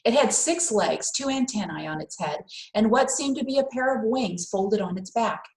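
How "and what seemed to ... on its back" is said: ordinary delivery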